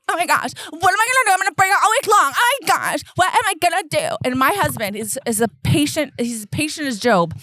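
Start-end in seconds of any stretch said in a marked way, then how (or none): 0.1-4.2 fast, higher, frantic whining; mock teen panic. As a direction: rush it like a panicking teenager. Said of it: "she's like".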